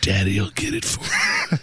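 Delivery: slow creepy mock whisper. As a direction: draw it out slowly, creepy. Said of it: "creepy whisper".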